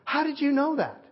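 imitating someone stunned at being found out; astonished. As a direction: blurt it high in amazement. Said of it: "her eyes go big".

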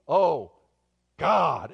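loud, split 'Oh... God' appeal; desperate prayer cry. Shown as a demonstration. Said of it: "Not the "Oh, God," the".

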